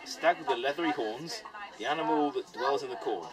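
put-on reciting an old list poem; poem recitation. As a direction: read it like a ballad of names. Reciting old poem of hare names.

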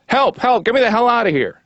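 high, loud yelled poem; high mock shout for help; cry for help. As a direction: scream it like a trapped kid. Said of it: "Here's one of the poems I wrote".

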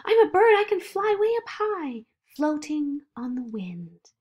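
put-on high, loud, soaring child's voice; Zoe, excited little girl. Zoe pretending to be a bird, excited. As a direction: take off with delight, then float on the last words.

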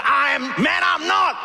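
high, rising shouted denial; panicked denial. Acted. Peter panicking as bystanders accuse him, high shout. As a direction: blurt it out in a fluster.

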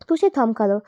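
usual speaking voice

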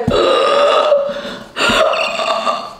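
a winded gasp with no air left; gasping for breath. Retold or imitated. A woman retelling the kick, gasping for breath. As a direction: suck for air after the kick. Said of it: "while I was fucking gasping for breath".

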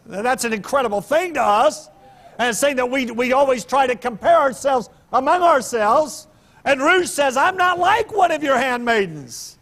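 loud, high preaching at full force; fiery preaching. Acted. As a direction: preach it out with fire.

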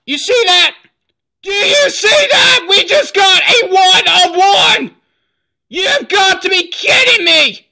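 shrieking, high and loud, voice cracking with joy; can't believe your luck, yelling at the camera; ecstatic screaming. Real, not acted. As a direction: scream it like you just hit the jackpot. Still screaming over the card.

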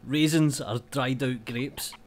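ordinary delivery

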